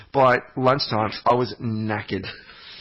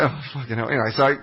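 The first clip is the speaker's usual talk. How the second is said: ordinary talk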